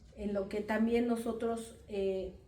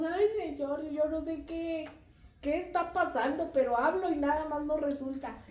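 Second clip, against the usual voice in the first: plaintive complaint; 5 semitones higher than usual. Retold or imitated. Voicing weary complaint to God.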